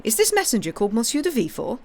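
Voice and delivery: fast, loud pressing question; demanding to know at once; urgent. A narrator's reading. King pressing Blacas about the messenger, urgent and quick. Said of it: "cried the king".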